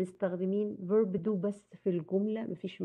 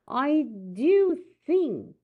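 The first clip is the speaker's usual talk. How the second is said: slow, raised emphasis on "do"; emphatic DO; 7 semitones higher, 4 dB louder, much slower than usual. Shown as a demonstration. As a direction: stretch out "do" in "I do think", high and slow. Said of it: "طبعًا emphatic".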